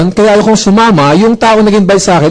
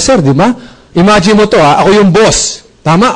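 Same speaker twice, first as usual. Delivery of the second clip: normal talking voice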